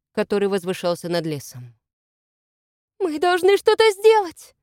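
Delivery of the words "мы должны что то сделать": high, loud, pleading wail; desperate moan. A narrator's reading. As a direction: plead helplessly for action. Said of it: "простонала Велиму".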